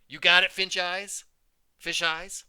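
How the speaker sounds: loud, high mocking jeer; taunting bully. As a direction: jeer it right in her face. Bully taunting her victim.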